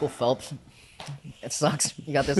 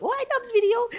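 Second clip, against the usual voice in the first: high squeak; 3 dB louder than usual. Retold or imitated. Retold reaction to YouTube video.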